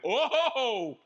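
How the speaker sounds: hammed-up nervous passenger cry; mock alarm. Passenger as the car backs up, mock alarmed cry. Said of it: "and I went".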